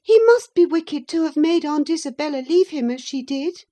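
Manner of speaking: put-on high, petulant boy's taunt; high whiny boy accusing; sickly boy voice. A narrator's reading. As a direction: sneer it like a spoilt invalid child. Linton voiced, scornful accusation.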